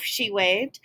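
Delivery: plain reading voice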